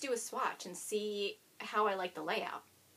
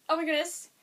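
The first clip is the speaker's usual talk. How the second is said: ordinary talk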